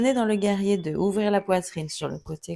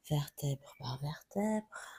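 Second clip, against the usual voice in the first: slow, softer yoga cue; 3 semitones lower, 8 dB quieter, slower than usual. Shown as a demonstration. Yoga teacher easing students up, slow and softer. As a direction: let the words unroll slowly and gently. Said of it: "tout doucement en vous déroulant".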